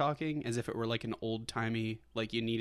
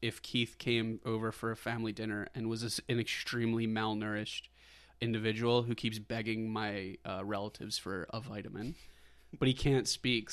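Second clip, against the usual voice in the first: ordinary talk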